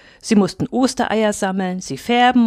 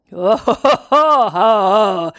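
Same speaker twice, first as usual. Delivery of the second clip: loud hooting belly laugh; delighted guffaw; 5 dB louder than usual. A narrator's reading. Wolfhound doubled up with pleasure, loud guffaw. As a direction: roar with amusement, trailing down. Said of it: "Krümmte sich da Lux vor Vergnügen".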